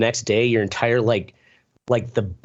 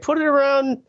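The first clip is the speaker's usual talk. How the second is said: put-on kids' voice; 12 semitones higher, slower than usual. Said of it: "they're like".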